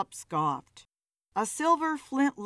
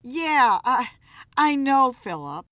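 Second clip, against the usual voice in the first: Kelly flustered; 4 semitones higher, 7 dB louder than usual. A narrator's reading.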